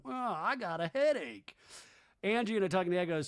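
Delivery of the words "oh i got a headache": higher-pitched mock whine; exasperated, complaining imitation; Andy. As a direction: groan it out in put-on misery. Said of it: "Andy going".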